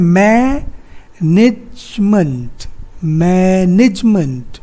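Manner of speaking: मैनेजमेंट slowly as मै-निज-मंट, ज running straight into म; three-syllable split. Shown as a demonstration. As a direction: stretch मै, then join निज-मंट. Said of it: "ज के बाद म तुरंत आ जा रहा है".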